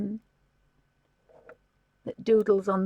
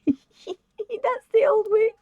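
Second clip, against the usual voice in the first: laughing, high; 12 semitones higher, 6 dB louder, much slower than usual. Real, not acted. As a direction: giggle it out, much higher and slower.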